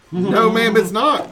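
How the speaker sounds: firm parent denial. Retold or imitated. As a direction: shut it down like a stern dad.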